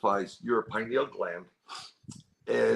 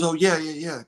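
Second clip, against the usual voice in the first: retold excited confirmation, pitched higher; eager agreement; 6 semitones higher, 4 dB louder than usual. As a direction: quote her quick, eager recognition. Retold wife eagerly confirming description.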